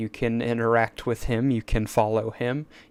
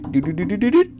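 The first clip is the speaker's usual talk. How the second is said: vocal drumroll; 7 semitones higher, much faster than usual. Shown as a demonstration. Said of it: "Drum roll, please".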